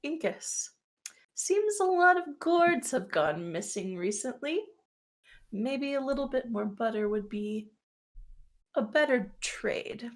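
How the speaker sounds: soft, knowing hint sinking to a whisper; hushed insinuation. Acted. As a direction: lean in quietly with a veiled threat. Gris leans in, hints at blackmail.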